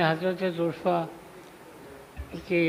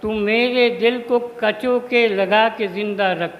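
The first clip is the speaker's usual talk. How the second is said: raised, sustained mushaira declamation; prayer poem recitation; 4 semitones higher, 7 dB louder than usual. Acted. Poet praying not to be wasted, declaimed recitation. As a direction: declaim the plea line by line, with pauses.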